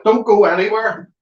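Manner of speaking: urgent called-out request to stay. Real, not acted. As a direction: shout it over your shoulder. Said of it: "Two seconds".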